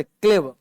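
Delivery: क्लेवर said as klevuh with no final r; silent r in clever. Shown as a demonstration. Teacher models clever for the class, r silent. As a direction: drop the r at the end of क्लेवर. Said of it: "आर चुप रहता है".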